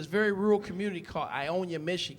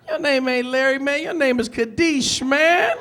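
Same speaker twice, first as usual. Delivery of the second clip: louder, higher, calling out the operator; mock indignant; 5 semitones higher, 8 dB louder, slower than usual. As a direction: scoff at the fake name, loud. Heckling the fake operator name.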